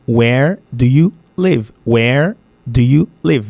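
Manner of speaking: slow "where... do you... live", pausing between words; teacher's slow, separated "Where do you live". Shown as a demonstration. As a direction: spell out "Where do you live" slowly, each word apart. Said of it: "il écrit, where do you live".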